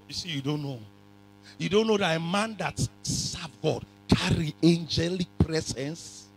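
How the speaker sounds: ordinary talk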